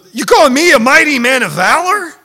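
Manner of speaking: loud, high-pitched challenge in disbelief; incredulous Gideon. Acted. Gideon questioning the angel's greeting, loudly incredulous. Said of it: "Now I imagine if I'm Gideon".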